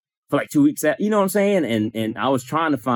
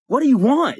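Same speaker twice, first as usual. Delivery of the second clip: high, loud mimicked demand; mock outburst, pitched way up; getting louder; 5 dB louder than usual. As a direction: imitate an irritated woman raising her voice sharply. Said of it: "started progressively getting louder".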